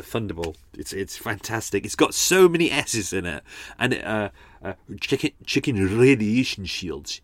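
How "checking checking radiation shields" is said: put-on Scottish Connery accent, "Chicken" said with a short i like chicken for checking; Connery's Scottish accent. Podcaster imitating Connery's line in a Scottish accent, "Chicken" for checking. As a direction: mimic Connery's Scottish accent, rolling the R in "radiation". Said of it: "He rolls on his R on there".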